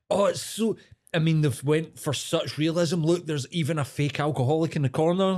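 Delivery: high, gushing food-critic voice; mock hipster reviewer. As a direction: gush over the pub like a trendy reviewer. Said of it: "like a hipster fucking doing a food review".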